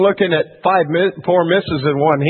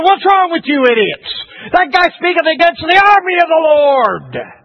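high, forceful outrage as David; indignant scolding; 9 semitones higher, 3 dB louder than usual. Retold or imitated. Preacher as David scolding his brothers, outraged. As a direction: scold them loudly, drawing out the end.

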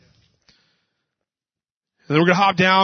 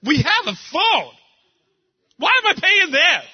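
high, rising mock rant; arguing against the photo booth, hammed-up high; 8 semitones higher than usual. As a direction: whine it in a high comic voice. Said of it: "She's like".

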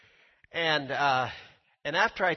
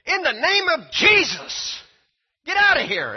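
high, loud shout casting the demon out; high shouted rebuke of a demon; excited command; 12 semitones higher, 10 dB louder than usual. Retold or imitated. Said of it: "Kenneth Hagin got so excited he finally said".

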